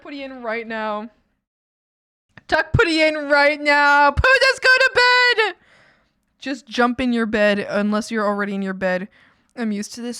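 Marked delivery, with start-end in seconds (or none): 2.5-5.5 high, loud pleading go to bed. Real, not acted. Streamer begs a viewer named Pootis to go to bed, high loud pleading. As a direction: beg them to sleep.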